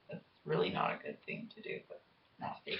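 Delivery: breathy low whisper. Pretend. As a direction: whisper it like a secret. Joking good girl aside.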